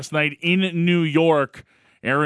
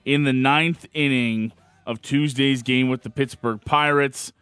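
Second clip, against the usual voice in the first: dramatic sports recap; 3 semitones higher, slower than usual. Acted. Dramatic recap after the clip.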